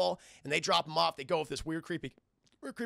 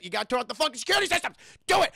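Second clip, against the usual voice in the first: fast, higher, rushed acted order; urgent demand; 6 semitones higher, 6 dB louder, much faster than usual. Penguin pushing the elf to drop security, urgent. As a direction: rattle it out into a yelled command.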